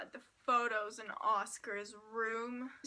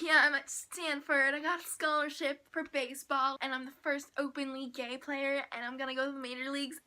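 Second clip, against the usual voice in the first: proud announcement; 8 dB louder than usual. Retold or imitated. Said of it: "Brian was like".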